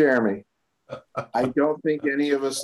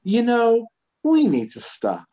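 counselor bursting in to interrupt; Carrie Fisher; 11 semitones higher, 5 dB louder than usual. Retold or imitated. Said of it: "Carrie Fisher as the counselor just bursts in".

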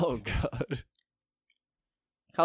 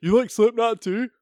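higher, louder eager metalhead; mock teen voice; 9 semitones higher, 4 dB louder than usual. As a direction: play an excited teen fan. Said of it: "acting an old chat room message".

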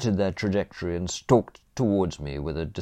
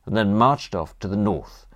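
ordinary delivery, nothing marked

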